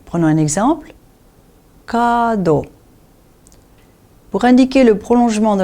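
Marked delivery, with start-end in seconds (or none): 1.9-2.7 first vowel of kado held; long A in kado. Shown as a demonstration. Teacher gives a katakana example, long A in kado. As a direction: stretch the A in kado. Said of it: "le prolongement des voyelles longues".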